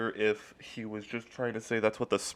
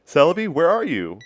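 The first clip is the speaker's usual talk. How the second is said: calling out; 8 semitones higher, 8 dB louder than usual. Retold or imitated. Calling out for lost Celebi.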